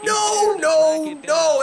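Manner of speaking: high, pleading string of nos; anguished no. Real, not acted. Gamer watching his hit fall short, crying out no in high dismay. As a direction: beg the ball not to be caught. Player's hit caught, game tense.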